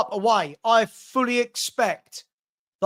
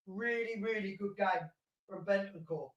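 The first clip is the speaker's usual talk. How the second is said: quiet aside; 15 dB quieter than usual. Real, not acted. Repeats the praise much quieter.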